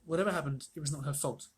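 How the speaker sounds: ordinary delivery, nothing marked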